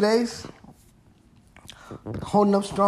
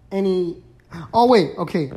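normal talking voice

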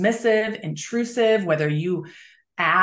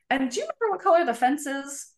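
put-on higher, quick playful quiz to a child; asking a child; 7 semitones higher, faster than usual. Shown as a demonstration. Parent quizzing child about the school fence, high and playful. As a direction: ask it like a fun game for a little one. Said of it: "So here's what's gonna happen".

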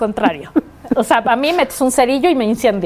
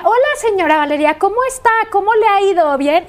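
mock chirpy nurse; 6 semitones higher than usual. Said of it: "no diga".

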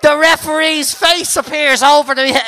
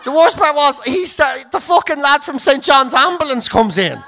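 ordinary delivery

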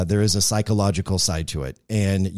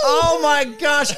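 embarrassed exclamation; slower than usual. Real, not acted. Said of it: "embarrassed outburst".

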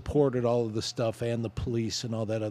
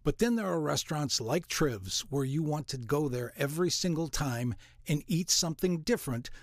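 ad read; 2 semitones higher, 5 dB quieter than usual. Acted. Reads restaurant ad.

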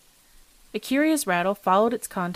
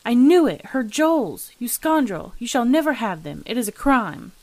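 loud, raised, indignant outburst; angry exclamation; 4 semitones higher, 6 dB louder than usual. A narrator's reading. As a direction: exclaim in anger, each phrase punched out. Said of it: "was heard an angry exclamation".

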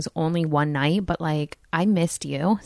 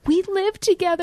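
high excited exclaim; 13 semitones higher, 3 dB louder than usual. Retold or imitated. Retold exclaiming to a friend.

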